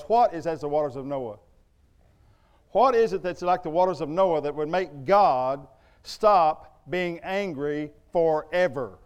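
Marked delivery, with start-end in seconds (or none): none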